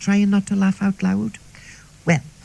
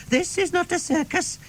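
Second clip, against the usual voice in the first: mock scolding; 12 semitones higher, 5 dB quieter than usual. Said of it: "Miss Harper shouts".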